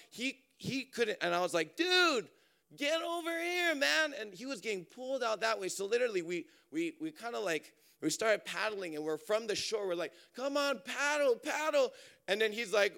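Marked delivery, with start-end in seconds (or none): 1.7-4.1 calling out. Retold or imitated. Speaker calls his drifting cousin back to shore, high shout. 10.3-11.9 higher-pitched call of encouragement; urging call. Retold or imitated. Friends urge the tiring cousin to paddle, high call.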